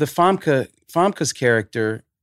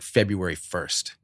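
usual speaking voice

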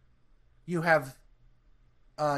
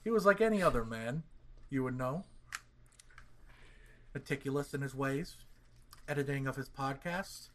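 hushed, ominous true-crime narration; suspenseful crime show narrator; 2 semitones lower, 7 dB quieter than usual. Acted. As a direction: build dread like a mystery host. Mock crime show intro narration.

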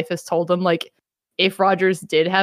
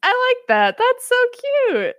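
much higher gushing aww voice; high sing-song cute voice; mock cooing; 13 semitones higher, much slower than usual. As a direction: coo over something adorable. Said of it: "everybody's like".

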